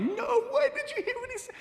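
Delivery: very high-pitched ecstatic squeal; hammed-up, sky-high excited shriek; losing her mind. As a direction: squeal it like you can't believe your luck. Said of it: "she lost her mind".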